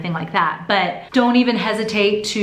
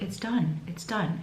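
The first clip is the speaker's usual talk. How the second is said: softer, hushed it's done; quiet despair; 10 dB quieter, slower than usual. Retold or imitated. Telling her husband in the waiting room, hushed despair. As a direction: murmur it like all hope is gone. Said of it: "I looked at Andy and I was like".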